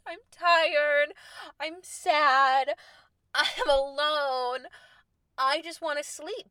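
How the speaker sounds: exhausted whine. Retold or imitated. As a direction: moan it out like a worn-out traveller, drawn out. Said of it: "exhausted and emotional Charlotte is like".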